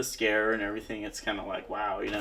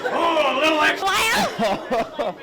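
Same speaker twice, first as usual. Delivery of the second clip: maniacal laughing; 7 dB louder, slower than usual. Acted. Scare actor laughing in character.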